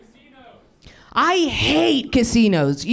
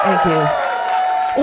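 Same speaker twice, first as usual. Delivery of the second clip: ordinary talk, nothing marked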